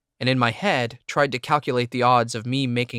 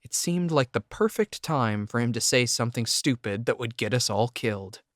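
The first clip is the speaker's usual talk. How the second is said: usual speaking voice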